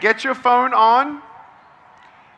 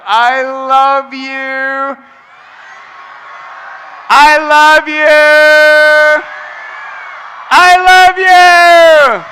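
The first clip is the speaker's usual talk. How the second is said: slow, drawn-out, rising higher and louder each time; building to a shout; 6 semitones higher, 9 dB louder, much slower than usual. Real, not acted. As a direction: swell from heartfelt to full-voice cry. Repeated declaration to audience.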